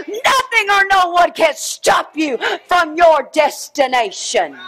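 rising fervent shout; preaching at full force. Real, not acted. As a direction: drive it home like a preacher.

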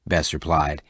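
plain reading voice

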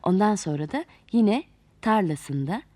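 usual speaking voice

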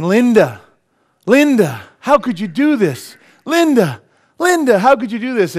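loud, high, despairing cry of a name; anguished wailing. Retold or imitated. Joke asylum patient wailing at Linda, loud and despairing. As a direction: wail the name like a broken man.

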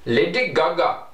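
put-on Indian accent. Shown as a demonstration. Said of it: "with an India people accent".

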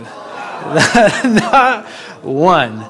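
rueful laugh. Real, not acted. Laughing at failed trap.

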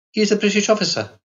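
lightly voiced insistent Carlotta; insistent young girl. A narrator's reading. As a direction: she is sure of it. Girl insists on her guess.

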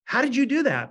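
high, loud astonished "How did you do that". Retold or imitated. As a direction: blurt it out in amazement. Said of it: "they're like".

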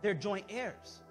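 usual speaking voice

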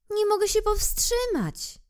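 apologize, caught off guard; embarrassed little prince. Acted. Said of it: "zmieszał się mały książę".

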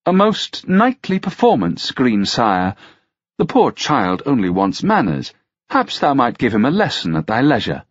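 high, lilting lady's voice; mocking noblewoman. Narrator voices the mocking lady.

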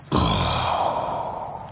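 deep, low, airless sigh; relieved sigh. Acted. Getting a shoulder rub from partner, relieved low sigh. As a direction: breathe out as the knot loosens.

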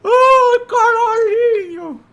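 high, loud drawn-out wail; anguished wail. Real, not acted. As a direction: wail in long despair. Falls in game, cries out.

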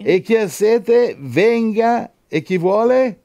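high, loud, slowed scripture call; fervent declaimed verse; leading a responsive reading. Real, not acted. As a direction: ring out each phrase to rouse them. Leads congregation in responsive verse.

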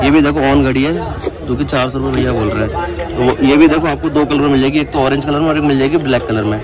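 ordinary delivery

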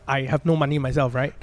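ordinary delivery

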